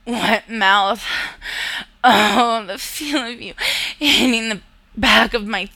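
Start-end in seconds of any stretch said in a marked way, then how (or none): none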